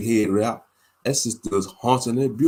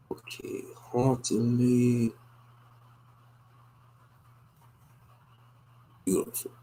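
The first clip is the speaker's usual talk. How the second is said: whispering; 3 semitones higher, 3 dB quieter, slower than usual. Real, not acted. Sounding out words while typing.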